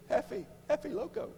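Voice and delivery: high laughing Spanish taunt; laughing mock workers. Retelling workers' nickname for him, laughing high. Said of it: "they say".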